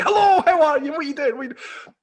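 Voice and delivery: high, loud, gushing greeting that fades at the end; overexcited Londoners. Pretend. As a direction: burst out chatting like you've been let off the leash. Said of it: "they'll just all be like".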